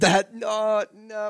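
high, loud protest; indignant protest. Real, not acted. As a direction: cry out in protest. Protesting the bad joke.

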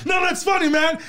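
high-pitched laugh, then high reassurance; put-on high laughing approval; forced laughing along. Fakes going along with hurtful jokes.

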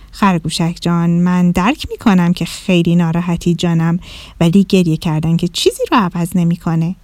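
put-on calm the crying bunny like a kind old teacher; gentle owl teacher.

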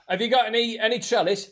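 lightly voiced shopper; customer voice. Voicing customer at counter.

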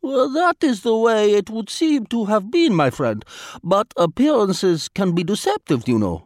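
put-on lightly accented, formal and unhurried Vladimir; a polite spy choosing words with care; foreign diplomat voice. A narrator's reading.

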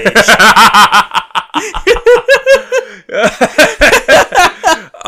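loud, high burst of laughter; long helpless laugh. Real, not acted. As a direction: crack up completely at a friend's impression. Cracking up at the impression.

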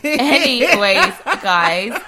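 loud, drawn-out change of subject; emphatic segue. Real, not acted. Co-host steering listeners back to the show, loud and drawn out. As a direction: stretch it out loudly to move on.

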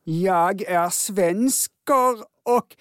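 put-on very slow, drawn-out Swedish accent parody; Danish comedian's mock Swedish. Host imitating Danish friend who mimics him, slow mock Swedish. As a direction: stretch every word in exaggerated mock Swedish. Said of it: "härma svenska, då säger han".

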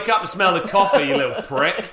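loud sneering scold; mock telling off. Friend scolding the elf, loud. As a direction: bark a mock reprimand.

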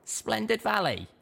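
high, plain little mare voice; a small pony chatting; high little pony voice, chuckling aside; young mare. A narrator's reading.

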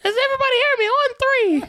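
put-on high boyish voice barking orders; unbroken boy voice. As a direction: command troops like a boy before his voice broke. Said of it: "my voice hadn't changed yet".

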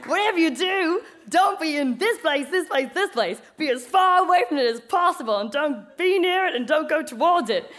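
hammed-up broadcaster urging everyone away; emphatic put-on radio voice, punching the warnings; radio announcer. Acts out radio evacuation warning.

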